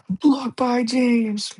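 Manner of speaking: high, drawn-out slow play-by-play call; announcer call. Retold or imitated. Fan recalling the iconic block, slow high announcer call. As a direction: stretch it like the famous broadcast.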